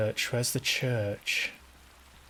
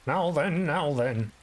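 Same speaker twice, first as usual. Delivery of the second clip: high, drawn-out 'now then'; Jimmy Savile impression; 5 semitones higher, slower than usual. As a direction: do a quick Jimmy Savile catchphrase. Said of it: "doing an impression of him".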